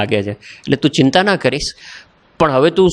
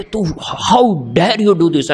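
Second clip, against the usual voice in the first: imitating outrage, raised pitch, drawn-out words; retold fury; 4 semitones higher, much slower than usual. Senior confronting junior who threw down cigarette, mock fury. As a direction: act a furious senior without shouting. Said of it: "એકદમ આગ બબૂલો થઈ ગયો".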